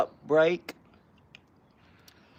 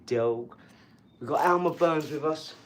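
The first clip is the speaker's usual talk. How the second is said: usual speaking voice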